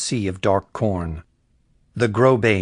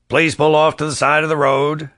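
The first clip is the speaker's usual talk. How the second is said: higher, louder voice through a car loudspeaker; sheriff on loudspeaker; 6 semitones higher, 4 dB louder than usual. Acted. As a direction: bark it like a cop over a roof speaker. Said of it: "his voice rasped through the loudspeaker".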